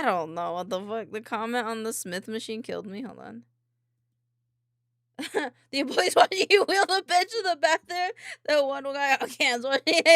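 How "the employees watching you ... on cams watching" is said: loud talk through laughter; laughing. Real, not acted. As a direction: crack up while telling it. Cracking up at a funny story.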